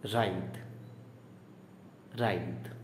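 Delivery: राइद said raith, rhyming with ride, soft th at the end; model pronunciation. Shown as a demonstration. Teacher gives the right sound of writhe. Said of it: "इसका pronunciation है".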